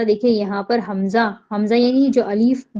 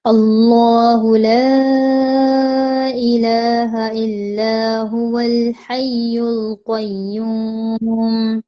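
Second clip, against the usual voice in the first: slow, drawn-out tajweed chant; Quranic recitation; much slower than usual. Shown as a demonstration. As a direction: recite it slowly, stretching each vowel. Recites Ayat al-Kursi.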